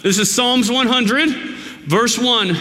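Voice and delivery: usual speaking voice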